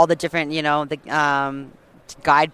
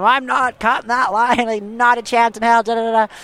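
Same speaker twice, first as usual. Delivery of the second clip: mock defiant swearing; 7 semitones higher than usual. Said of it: "I'm swearing at him".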